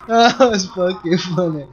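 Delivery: ordinary talk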